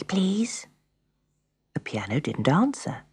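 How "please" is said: PLEASE in a soft whisper, rising. A narrator's reading. Pat to the grand piano, PLEASE whispered softly. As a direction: whisper PLEASE as a timid request. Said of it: "she whispered to the grand piano".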